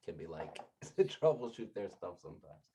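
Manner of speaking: normal talking voice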